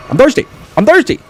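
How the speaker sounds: high loud cry of I'm thirsty; desperate shouted complaint; yelled whine. Real, not acted. Needs healing drink.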